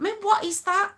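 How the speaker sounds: high incredulous question. Real, not acted. To viewers, about her own face, high incredulous. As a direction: squeal at the picture. Reacting to funny frame.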